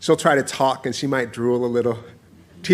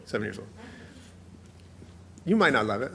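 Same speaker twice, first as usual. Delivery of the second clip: usual speaking voice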